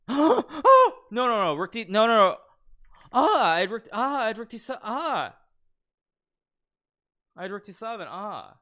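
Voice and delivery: high, loud no no no; anguished shriek. Real, not acted. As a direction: cry out in dismay at the miss. Realizes missed winning move.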